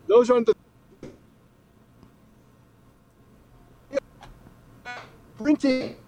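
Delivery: choppy dropping-out connection; words breaking up mid-call; frozen video call. Real, not acted. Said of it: "You're breaking up pretty bad".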